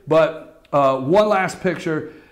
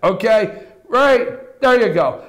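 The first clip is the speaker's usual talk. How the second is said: ordinary talk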